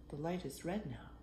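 ordinary delivery, nothing marked

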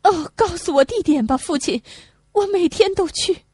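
put-on high, eager young woman's plea. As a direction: beg him for the place, eagerly.

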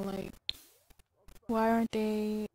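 normal talking voice

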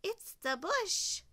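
high, exclaiming animal character; lifted, eager sheep voice; excited sheep cry. A narrator's reading. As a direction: cry out the discovery. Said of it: "cried sheep".

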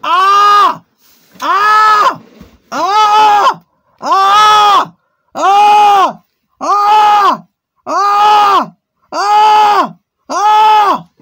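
short high screams, one after another; a string of loud "Ah!" yells with short breaths between; repeated screams. Pretend. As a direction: scream at the hard drive, over and over. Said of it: "I'm going to scream at the hard drive".

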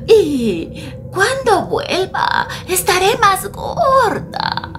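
pleading grandma. Acted. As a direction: beg with a catch in the voice.

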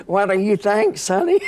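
put-on high, cheeky old lady's jab; old lady taunting. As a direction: tease like a spry granny.

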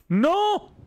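a jolt of real fright; startled cry. Real, not acted. Thinking the game crashed, startled shout. Uf, qué susto.

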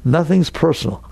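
ordinary delivery

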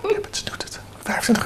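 hushed aside, voice gone to breath; conspiratorial whisper. Acted. Host whispering the price aside to the viewers. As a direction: whisper it behind her back. Aside to audience about fee.

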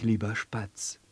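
ordinary delivery

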